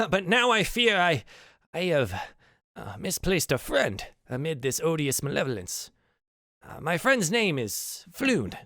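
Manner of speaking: theatrical storyteller with swooping pitch; self-important wizard Volo. Acted. Celebrity wizard begs strangers to find his friend, florid pleading. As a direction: grandly introduce yourself, then appeal with hesitant chuckles.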